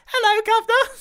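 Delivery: high cartoon mouse voice; squeaky mouse voice. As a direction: greet them as a tiny cheerful mouse. Mouse character greeting.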